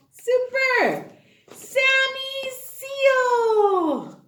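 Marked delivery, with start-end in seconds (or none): 1.5-4.2 drawn-out intro. Acted. Introducing puppet character.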